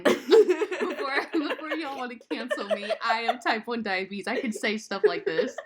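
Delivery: laughing through the disclaimer. Real, not acted. Diabetic friend laughing off her diabetes joke, giggling. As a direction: giggle it out, half apologizing.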